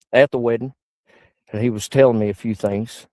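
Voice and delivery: normal talking voice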